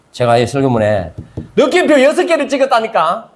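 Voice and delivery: usual speaking voice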